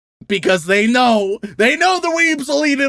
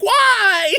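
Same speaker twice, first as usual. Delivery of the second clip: a high, pleading why; despairing; 10 semitones higher than usual. Real, not acted. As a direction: despair at the whole industry. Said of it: "The despair in your voice".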